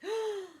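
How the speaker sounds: sharp high gasp of alarm; startled storyteller gasp. Acted. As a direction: gasp at the sight.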